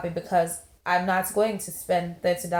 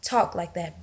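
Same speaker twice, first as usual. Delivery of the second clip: normal talking voice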